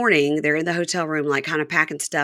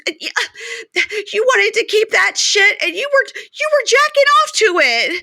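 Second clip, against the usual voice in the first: much higher, louder mock rant; voicing Joy ranting; 13 semitones higher, 3 dB louder than usual. Podcaster as Joy ranting at Red, high and loud. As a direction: fire off jealous accusations.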